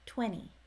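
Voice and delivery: Twenty said as twenny; Twenty with no T sound, N straight into the ending; skipped T. Shown as a demonstration. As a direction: drop the T after the N in Twenty. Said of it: "there's no T or D sound".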